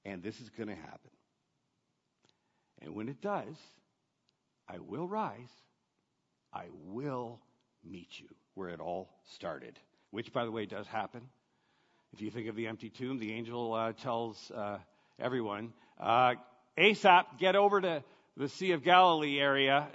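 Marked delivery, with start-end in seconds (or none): 0.0-9.8 soft, gentle voice for Jesus comforting friends; Jesus tenderly reassuring his frightened disciples, soft and intimate; tender reassurance. Retold or imitated. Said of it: "It's like he's saying". 16.8-19.9 louder, brisk mock urgency; urgent messenger. Angel urgently sending disciples off.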